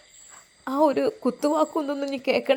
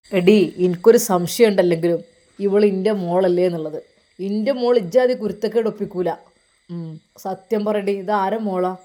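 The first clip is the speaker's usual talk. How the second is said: lower, louder furious shout; furious father; 5 semitones lower, 5 dB louder than usual. Acted. Father doubting his daughter, shouting at wife. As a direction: roar the accusation at the wife.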